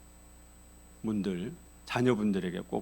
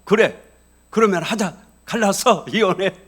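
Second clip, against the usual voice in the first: loud, proud snap back, breaking into a laugh; defiant angry retort; 9 semitones higher, 11 dB louder than usual. Retold or imitated. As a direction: shout it out of wounded pride. Spouse angrily agreeing to divorce. (자존심 때문에 큰 소리로 맞받아치는 배우자)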